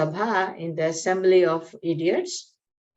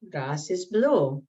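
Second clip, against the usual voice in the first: slow, calm lion's verdict; 3 semitones lower, slower than usual. Acted. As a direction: state it calmly and slowly as the lion. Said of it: "So very calmly he says".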